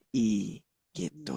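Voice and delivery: i with only a slight smile, slow and soft; the correct Quranic kasrah i; small smile vowel i. Shown as a demonstration. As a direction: barely smile on i and drop the jaw. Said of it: "Senyum dikit aja".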